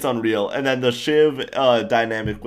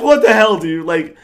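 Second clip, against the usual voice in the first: loud, high, shocked outburst; mock-indignant shout at your wife; outraged yell; 7 semitones higher, 9 dB louder than usual. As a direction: blurt it out like you can't believe your ears. Imagined reaction to wife's request.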